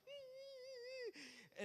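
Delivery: high, thin drawn-out whine; mock crying. Daughter making Rapunzel cry, high mock wail. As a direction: imitate a toy figure's squealing cry. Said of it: "she's, like, crying".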